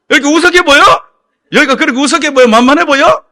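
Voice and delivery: high, very loud scolding shout; angry yelling. Retold or imitated. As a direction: roar at them for disrespect. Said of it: "소리 지르고 야단". (무섭게 호통치듯)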